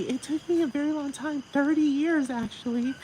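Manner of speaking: mock him way up high, dragging the last line; high, hammed-up parody voice; mocking imitation. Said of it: "He was like".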